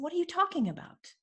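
high-pitched indignant retort; angry disbelief. Retold or imitated. Client challenging coach who says she's not ready, indignant. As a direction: retell it as an affronted, high protest. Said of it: "I got so angry".

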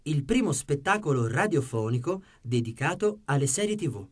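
ordinary talk, nothing marked

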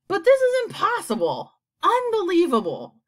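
high, loud exclamations; disbelief. A narrator's reading. Creatures atop the peach, loud high disbelief. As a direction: cry out high, astonished.

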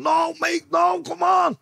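high, loud urgent plea; desperate shout. Retold or imitated. Working on his dying mate, desperate high shout. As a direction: cry out to a slipping friend.

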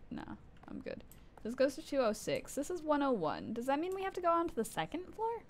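normal talking voice

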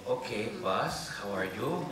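slow, put-on Mexican accent; caricatured Mexican-accented greeting to the boss; Mexican assistant. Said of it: "it's a Mexican assistant".

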